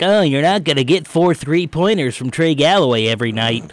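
lightly imitating a sports radio host; mock know-it-all host voice; Spears impression. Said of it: "is that your Spears impression".

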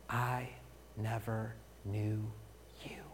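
Jesus' words whispered as a warning; hushed whisper. Acted. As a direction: whisper it low and slow. Voicing Jesus rejecting the faithless.

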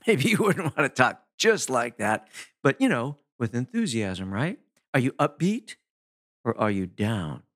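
ordinary delivery

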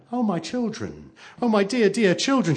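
high-pitched motherly alarm. A narrator's reading. Mother meeting her crying children, alarmed. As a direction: cry out in concern for the children.

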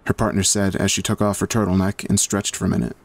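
usual speaking voice

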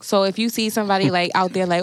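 ordinary talk

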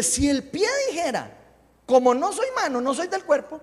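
high, loud proclaimed verse; scripture reading. Real, not acted. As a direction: read it out forcefully to the congregation. Reads scripture aloud. (lectura bíblica enérgica, en voz alta)

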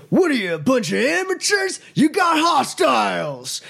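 high, loud, barked command; angry shout. Acted. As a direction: yell it at a bunch of rookies. Guard NPC yelling at intruders.

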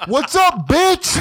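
high, loud street yell; shouted taunt. Retold or imitated. Guy yelling from the street.

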